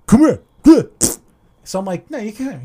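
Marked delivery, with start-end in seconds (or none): 0.1-0.9 loud, high, threatening; imitating an aggressive driver; aggressive bark. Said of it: "this kid's like". 2.1-2.6 high, soft taunt; a touch of mockery; mocking back. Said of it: "So I'm doing it back to him".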